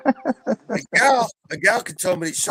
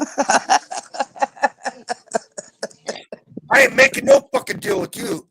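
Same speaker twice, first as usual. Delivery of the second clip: louder, higher defiant refusal; a loud no-deal snap at the host; defiant shout; 14 semitones higher, 6 dB louder than usual. Real, not acted.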